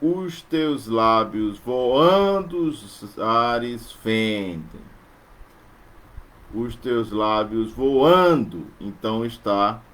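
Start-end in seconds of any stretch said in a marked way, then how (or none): 0.0-4.8 very slow, stretching every syllable; very slow metrical scansion; slow scansion reading. Shown as a demonstration. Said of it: "no meio da palavra voando". 6.5-8.7 slow, with the stress on the AN of "voando"; slow reading stressing "voando". Shown as a demonstration. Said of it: "no meio da palavra voando".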